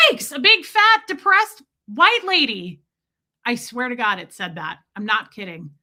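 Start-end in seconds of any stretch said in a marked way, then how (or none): 0.1-2.8 comic panicked creature voice; startled talking snake. Retold or imitated. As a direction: play a snake screaming in horror. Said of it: "this thing went".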